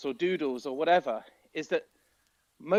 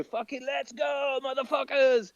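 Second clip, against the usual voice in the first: hyped-up yelling; 11 semitones higher than usual. Retold or imitated. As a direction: pump up the crowd. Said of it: "where you go".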